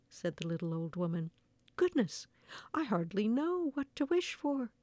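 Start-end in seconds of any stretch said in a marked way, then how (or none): 1.8-4.7 higher-pitched exclamation of bewilderment; crying out, flustered. A narrator's reading. Old man unable to choose a wish, crying out. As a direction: exclaim it like a storybook character at a loss. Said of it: "cried the little old man".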